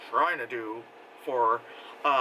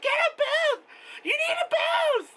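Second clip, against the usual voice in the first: high, loud insistent fan voice; imitating a fan yelling encouragement; mock pushy yell; 3 dB louder, slower than usual. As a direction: bark it at her like a pushy mom. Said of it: "felt like I was being screamed at".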